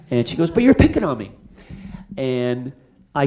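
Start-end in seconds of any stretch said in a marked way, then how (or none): 0.5-1.3 put-on high-pitched complaining young woman; whiny protest. Actress complaining to the director, high whiny protest. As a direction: whine it, feeling picked on.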